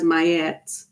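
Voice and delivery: Mayet said "my-ET"; two syllables in Mayet, stressed "et" at the end; pronounced Mayet. Shown as a demonstration. Reporting what she has heard, Mayet as "my-ET". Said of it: "I've heard people pronounce it as".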